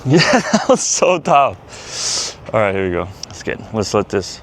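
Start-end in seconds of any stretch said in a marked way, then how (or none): 0.1-2.4 slow, breathless and higher; out of breath. Real, not acted. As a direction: drag the words out, winded. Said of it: "I'm, like, running out of breath".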